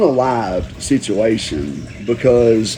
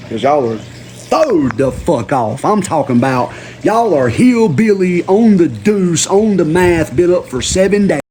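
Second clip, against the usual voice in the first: mock hillbilly voice; 5 semitones higher than usual. Mocking unhinged hillbillies.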